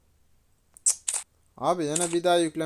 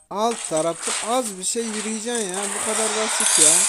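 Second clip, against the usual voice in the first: higher and louder, exasperated; 6 semitones higher, 4 dB louder than usual. Real, not acted. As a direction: throw up your hands at the spinner. Scolding the spinner.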